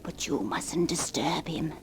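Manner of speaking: hushed warning whisper. Acted. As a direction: caution her softly. Warning to keep quiet.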